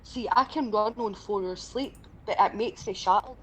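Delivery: normal talking voice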